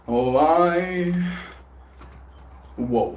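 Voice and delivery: normal talking voice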